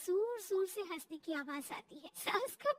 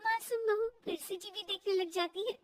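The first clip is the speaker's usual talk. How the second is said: plain reading voice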